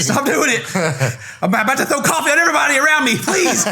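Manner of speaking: high, loud, laughing protest; mock exasperated pleading. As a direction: beg as if about to snap. Mock reaction to the accent.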